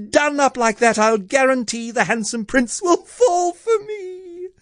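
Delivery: very high, hammed-up tantrum voice; high-pitched demanding character voice; shouted demand. A narrator's reading. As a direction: reel off demands as a shrill, spoilt Cindy. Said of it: "beat her fist against the wall and shouted".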